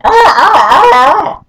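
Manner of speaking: high, loud repeated monkey alarm cries; monkey alarm call. Retold or imitated. Teacher imitating a vervet monkey's alarm call, high and loud. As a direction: shriek the warning like a vervet monkey. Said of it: "they go".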